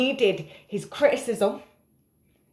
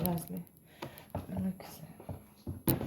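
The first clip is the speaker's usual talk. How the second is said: whispering to herself while tired; 13 dB quieter than usual. Real, not acted. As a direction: breathe it out, exhausted. Tired, looking for her drink.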